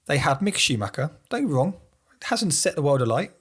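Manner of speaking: ordinary talk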